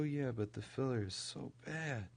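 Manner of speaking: normal talking voice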